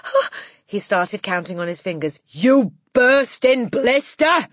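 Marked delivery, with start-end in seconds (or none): 0.1-0.3 flustered, very high and fast; Wilfred. A narrator's reading. Flustered boy struggling to add up, high panicked gabble. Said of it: "Poor Wilfred was properly flustered". 2.3-4.5 furious, drawn-out yell; Trunchbull. A narrator's reading. Headmistress exploding at a boy's counting, loud angry yell. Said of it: "yelled the Trunchbull".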